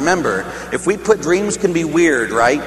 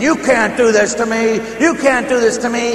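high-pitched protest, semi-screamed; retold screaming; 7 semitones higher, 3 dB louder than usual. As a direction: cry out in retold panic at the devil. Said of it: "And I'm screaming".

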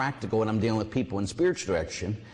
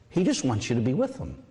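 ordinary talk, nothing marked

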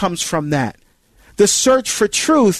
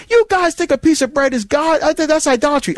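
higher, scornful questioning; challenging critic; 8 semitones higher than usual. Retold or imitated. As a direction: voice a critic accusing of idolatry. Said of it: "someone say".

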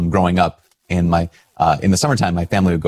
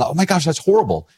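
pitched-up shocked lady; church lady quote; 9 semitones higher than usual. Retold or imitated. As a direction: mimic her gasp at "horrible", voice raised. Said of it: "her saying".

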